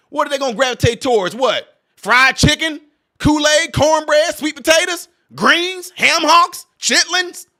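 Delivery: high, loud mock-questioning list; sarcastic, incredulous comic suggestions; making fun. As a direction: pitch each food up like an absurd guess. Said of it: "I know that I'm making fun of this stuff".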